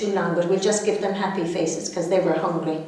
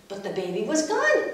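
ordinary delivery

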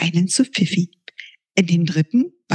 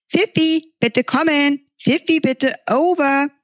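higher, eager radio call; girl calling over walkie-talkie; 8 semitones higher than usual. Acted. Momo calls Pfiffi over the walkie-talkie, higher eager call. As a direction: call your friend through the walkie-talkie.

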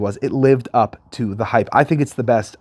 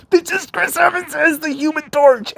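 very high-pitched, squeaky mock amazement; astonished excitement. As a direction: squeal out the big reveal, sustained high. Acting out the astonished cameo realization.

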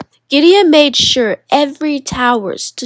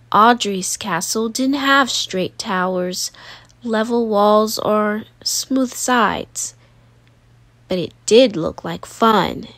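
usual speaking voice

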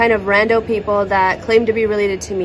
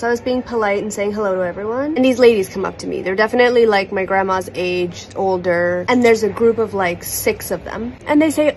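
ordinary talk, nothing marked

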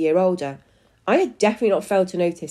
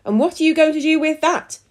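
high, louder anxious question; a little nervous; 9 semitones higher, 5 dB louder than usual. A narrator's reading. Husband eyes the spray bottle, a little nervous. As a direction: ask warily, pitched up high. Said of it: "sounding a little nervous".

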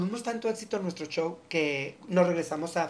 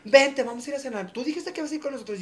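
high, loud, fast urging; eager urging; 8 semitones higher, 5 dB louder than usual. Retold or imitated. Friend urging him to join dinner, high and eager. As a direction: push him to come to dinner, excited. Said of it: "las gotas me hablaron".

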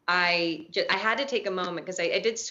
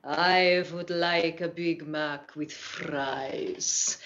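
put-on slow, ominous Old Norse accent; 2 semitones lower, much slower than usual. Shown as a demonstration. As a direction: read a fast-food order like a sinister Old Norse saga. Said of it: "in an Old Norse accent".

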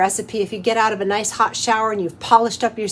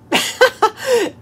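high, loud burst of laughter; 13 semitones higher, 5 dB louder than usual. Real, not acted. Host amused by her bergamot debate, high burst of laughter.

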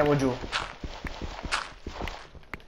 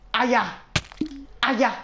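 1 lamento di dolore; 7 semitones higher than usual. Real, not acted. Colpito dai mobs.

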